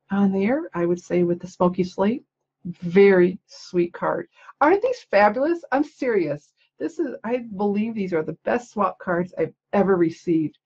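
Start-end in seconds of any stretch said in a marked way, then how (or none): none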